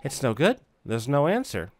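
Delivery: ordinary talk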